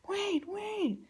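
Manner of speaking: put-on high-pitched child calling out; imitated little daughter. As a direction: mimic a kid pleading to hold on. Voices daughter calling her back.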